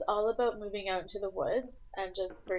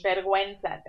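Spanish; 7 dB louder than usual. Shown as a demonstration. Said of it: "whip out some Spanish".

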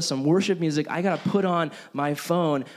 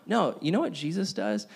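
ordinary talk, nothing marked

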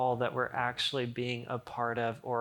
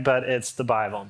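ordinary talk

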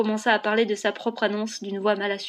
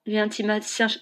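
ordinary delivery

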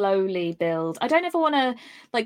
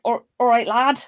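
a light northern Yorkshire greeting; Yorkshire accent; 3 semitones higher, 5 dB louder than usual. Retold or imitated. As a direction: say it like a friendly Yorkshire lad. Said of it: "I don't even, like".

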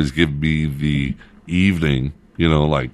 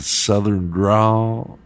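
southern drawl; 5 semitones higher, much slower than usual. Shown as a demonstration. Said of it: "the accent".

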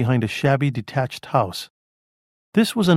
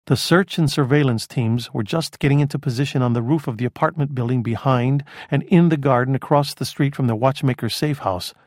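usual speaking voice

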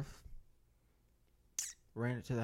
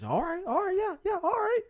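very high falsetto imitation; high mock voice. Someone answers the threat to whoop anyone, high mock falsetto. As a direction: squeak it out far above your usual voice.

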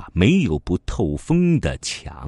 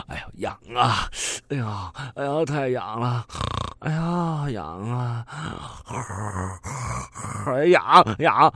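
itchy groaning; 6 semitones higher, 3 dB quieter than usual. Acted. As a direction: groan and toss from the itch. Thief itching in his sleep. (睡梦中痒得呻吟，最后叫出声)

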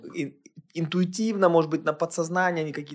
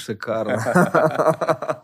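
drawn out through a laugh; much slower than usual. Real, not acted. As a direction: savor the word while laughing. Echoes guest's phrase, laughing.

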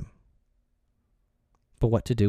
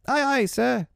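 high, squeaky sailor's reply; obedient boy. A narrator's reading. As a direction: answer the captain promptly in a high voice. Curly answers captain's order.

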